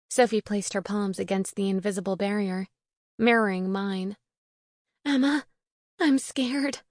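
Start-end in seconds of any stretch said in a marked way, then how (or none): 5.0-6.8 higher voice, a touch of fear; mildly frightened plea; scared friend. Acted. Jailed Sophie confides in her friend Emma, mildly scared.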